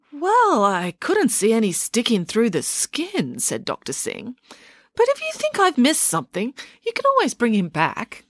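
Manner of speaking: vague and unbothered; elderly doctor. Acted. Scene: doctor answering Joe about bones, high and doddery.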